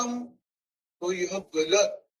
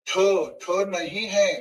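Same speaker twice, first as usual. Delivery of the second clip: ordinary delivery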